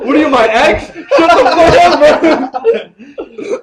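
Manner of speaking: loud, high, cracking up while telling him off; howling with laughter at the friend; laughing retort. Real, not acted. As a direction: tease him through laughter.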